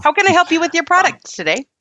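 bright call-center greeting; customer service voice. Pretend. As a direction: play a chirpy support agent. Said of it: "pretend like we are taking calls for customer service".

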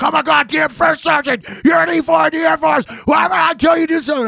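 high, loud mock shouting, rapid at the end; sergeant's furious yell. Marine first sergeant berating an E four, shouting. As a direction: bellow like an outraged first sergeant.

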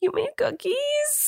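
high, slow, thrilled question; delighted surprise. Real, not acted. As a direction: squeal with joy at the treat. Cookies revealed as surprise.

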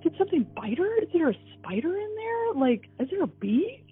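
high, rushed worried questioning; frantic mother guessing what hurt her baby; panicked questions. Retold or imitated. Panicked mother imagining baby's danger.

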